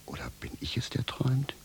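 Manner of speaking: a man doubting he is awake, whispering; hushed wonder. Acted. Shoemaker wonders if he is dreaming, hushed whisper. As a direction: drop to a near whisper, wondering aloud.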